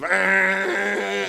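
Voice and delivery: loud, high imitated motor blare; leaf blower drone. Leaf blowers still roaring during the pitch, imitated blare.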